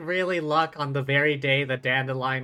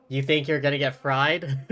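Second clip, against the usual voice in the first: ordinary talk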